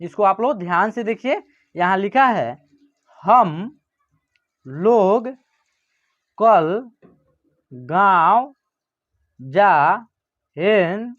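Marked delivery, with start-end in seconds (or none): 3.2-11.1 slow, each word spoken apart with pauses; slow dictation for students. Shown as a demonstration. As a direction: read the sentence one word at a time. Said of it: "एक एक शब्द को जो तोड़ के पढ़िएगा".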